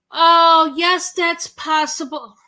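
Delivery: high, loud and close to tears; teary, moved. Real, not acted. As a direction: fight back happy tears. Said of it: "you're gonna make me cry".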